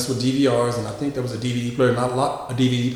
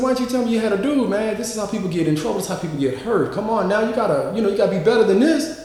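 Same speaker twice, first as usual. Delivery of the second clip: higher-pitched, quick retold reproach; exasperated scolding; 7 semitones higher, faster than usual. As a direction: remonstrate with a friend in a raised pitch. Retold scolding of friend over boyfriend.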